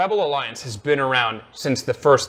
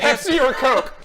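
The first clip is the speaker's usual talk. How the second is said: mock quizmaster; 10 semitones higher, 3 dB louder, slower than usual. Mocking Game Guy's one answer.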